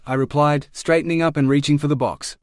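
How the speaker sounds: ordinary delivery, nothing marked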